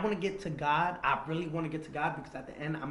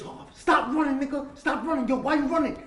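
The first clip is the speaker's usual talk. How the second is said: much higher and louder shouts after a runner; retold yelling; 11 semitones higher, 9 dB louder than usual. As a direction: yell it like you're chasing someone down the street. Pursuers yelling after him.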